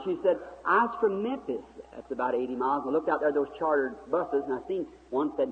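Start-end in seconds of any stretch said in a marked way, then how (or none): 0.6-1.6 higher, slow imitated Southern girl; imitated Southern accent, pitched up; Southern talk. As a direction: answer slowly as a Southern girl. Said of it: "that Southern talk".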